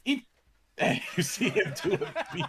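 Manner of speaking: high, helpless laughing through the words; real laughter breaking up the sentence. Real, not acted. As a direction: try to narrate while cracking up. Cracks up after the screamed order.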